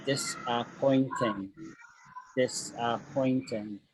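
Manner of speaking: "Disappointing" drawn out syllable by syllable; very slow, careful model of "Disappointing". Shown as a demonstration. Teacher modelling a word for students, slow "Disappointing" in separate syllables. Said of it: "And full, full speed".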